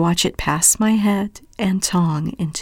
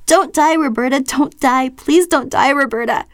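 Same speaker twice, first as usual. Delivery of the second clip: high, loud tearful pleading; crying plea; 7 semitones higher, 3 dB louder than usual. A narrator's reading. Tearful sister begging Roberta, high and loud. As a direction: beg her not to die. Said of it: "wet with streaks of crying".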